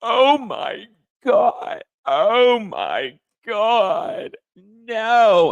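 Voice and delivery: high, slow, loud hammed-up gasping disbelief. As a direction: overplay a stunned star's tearful shock. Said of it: "She was like, gasps".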